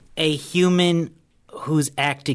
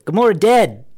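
higher, louder, punched-out objection; insistent interjection; 6 semitones higher, 5 dB louder than usual. Real, not acted. Cutting in on the friends' film idea, loud and insistent. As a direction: cut in loudly to be heard.

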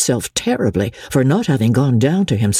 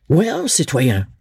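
ordinary delivery

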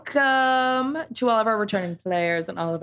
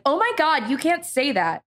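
higher, mock-shocked friend; 6 semitones higher than usual. Friend voiced in a story.

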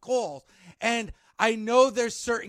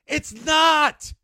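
louder, higher it's not; insisting at a raised pitch; bigger emphatic denial; 5 semitones higher, 6 dB louder than usual. Real, not acted. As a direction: hammer the denial home louder. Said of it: "second, bigger denial".

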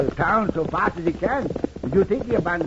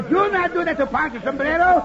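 indignant; 8 semitones higher than usual. Acted. Egg broken in his hat.

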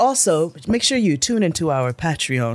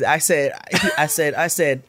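normal talking voice

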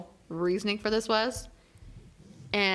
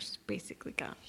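soft resigned whisper; 6 semitones lower, 14 dB quieter than usual. Real, not acted. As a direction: let it fall to a whisper. Fearing character is lost.

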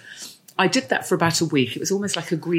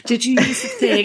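higher fussing-mum question; mumming; 8 semitones higher, 4 dB louder than usual. Acted. Mum quizzing her son when he phones, playfully fussing. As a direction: play the mum checking up. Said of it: "Mumming, mumming, mumming, mumming".

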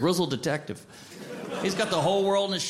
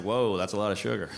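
put-on stoned teen voice; 10 semitones lower than usual. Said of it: "he's like".

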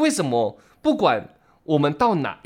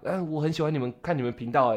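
much softer, bashful praise; shy fan; 3 semitones lower, 8 dB quieter than usual. Retold or imitated. Fan tells the hosts he watches their channel, shyly. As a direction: say it shyly to someone you admire. Fan confesses liking them.

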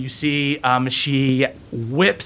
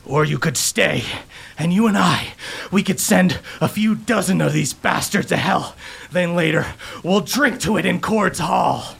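warrior coaxing his wife, higher; tender warrior; 6 semitones higher than usual. Acted. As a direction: hold her close and tempt her. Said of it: "and he goes".

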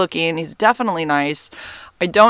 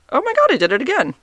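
surprised; 11 semitones higher, faster than usual. Real, not acted. Startled by her own hand pop.

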